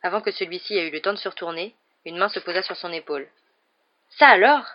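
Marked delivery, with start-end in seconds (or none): 4.2-4.6 loud, higher-pitched astonishment; amazed exclamation. A narrator's reading. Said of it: "s'exclama Stan".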